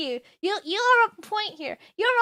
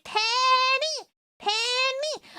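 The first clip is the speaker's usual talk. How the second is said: fan call; 6 semitones higher, much slower than usual. Retold or imitated. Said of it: "they're going".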